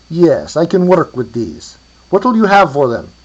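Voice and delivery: put-on loud, high Hungarian-accented voice, slow to begin. A narrator's reading. Inspecting the bones and asking the price, Hungarian accent. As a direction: draw out the start, in a Hungarian accent. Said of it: "with a Hungarian accent".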